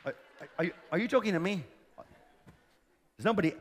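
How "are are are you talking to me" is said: fast, soft, stammering disbelief; lightly voiced nervous Gideon; timid stammer. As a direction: mumble it as a shrinking nobody. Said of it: "I can just imagine Gideon going".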